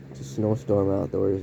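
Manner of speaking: ordinary talk, nothing marked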